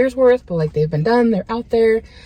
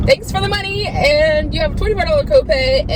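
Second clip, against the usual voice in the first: higher, falsely cheerful thanks; hammed-up chirpy insurer; mock insurer, sweetly; 8 semitones higher than usual. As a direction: play the smug company, sugary.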